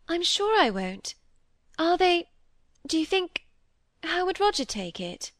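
high, hesitant voice with pauses; very timid. A narrator's reading. Molly asks Cynthia how Roger would take it, very timidly. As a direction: feel your way timidly into the question. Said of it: "asked Molly very timidly".

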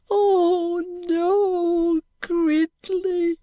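high, drawn-out wail, not at full volume; anguished scream. A narrator's reading. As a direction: wail his name high in grief. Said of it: "The roof rang with a scream".

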